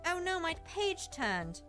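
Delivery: ordinary talk, nothing marked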